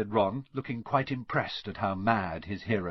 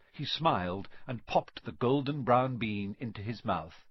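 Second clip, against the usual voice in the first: ordinary delivery, nothing marked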